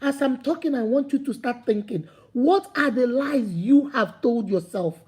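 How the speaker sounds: normal talking voice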